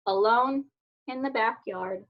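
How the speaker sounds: ordinary delivery, nothing marked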